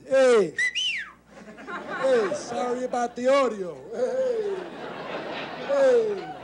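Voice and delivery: loud, high "Hey!" hollers; Italian street guy calling. Retold or imitated. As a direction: yell it like a guy across the street. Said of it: "street guys".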